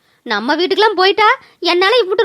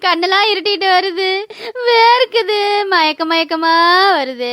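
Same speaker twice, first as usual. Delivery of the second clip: slow, tearful, stretched-out words; melodramatic dying wail; slower than usual. Acted. Aunt believes poison is working, drawn-out wail. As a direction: moan through each symptom, building to a long wail.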